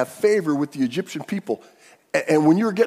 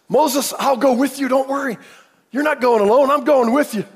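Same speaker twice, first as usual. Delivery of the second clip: reassuring God; 10 semitones higher, 8 dB louder than usual. Acted. Preacher voicing God reassuring Moses.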